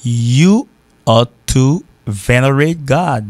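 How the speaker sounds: slow, word-by-word English example; slow model sentence. Shown as a demonstration. Teacher models an English translation for learners, slowly. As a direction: stretch each word with gaps between. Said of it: "En même m'a dit".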